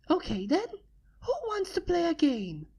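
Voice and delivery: high, bouncy party-pony voice; bubbly Pinkie. Acted. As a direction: invite everyone to play, excited. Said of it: "asked Pinkie".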